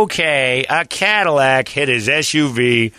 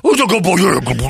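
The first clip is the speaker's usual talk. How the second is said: high, louder sing-song pet talk, laughing; cop's dog praise; 9 semitones higher, 4 dB louder, faster than usual. Retold or imitated. Officer praising the dog after the attack, sing-song. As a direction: gush over the dog like a proud handler.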